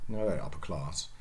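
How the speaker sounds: ordinary talk, nothing marked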